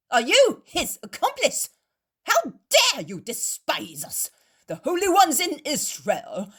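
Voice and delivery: loud, high furious accusation; shouting. A narrator's reading. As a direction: tear into the innkeeper with outrage.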